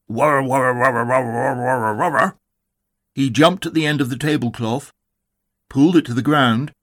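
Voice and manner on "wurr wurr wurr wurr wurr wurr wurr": drawn-out rolling creature noise; repeated rolling wurring, animal on the attack; animal war cry. A narrator's reading. Tigger leaping at the tablecloth, with a rolling animal cry. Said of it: "And with a loud".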